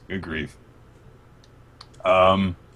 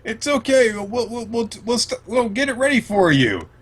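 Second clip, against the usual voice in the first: high, nervous placating servant voice; frantic high pleading to calm her; flustered servant; 14 semitones higher than usual. Retold or imitated. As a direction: squeak out reassurances to a raging boss. Servant soothing raging Big Mom.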